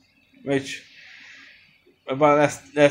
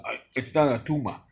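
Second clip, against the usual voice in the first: put-on Schwarzenegger Austrian accent. Reviewer imitates Schwarzenegger's tumor line in an Austrian accent. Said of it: "with his Austrian accent".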